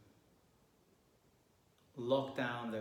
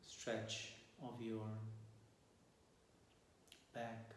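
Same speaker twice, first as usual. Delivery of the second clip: softer, unhurried meditation voice with long pauses; guided meditation; 2 semitones lower, 8 dB quieter than usual. Acted. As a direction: guide listeners calmly into their breath and posture.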